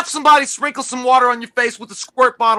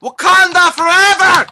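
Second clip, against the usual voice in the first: higher, louder, slow battle call; rallying cry; 7 semitones higher, 4 dB louder than usual. Acted. Co-host on cue, slow rallying war cry. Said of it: "Jay, you ready?".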